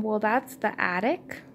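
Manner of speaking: ordinary delivery, nothing marked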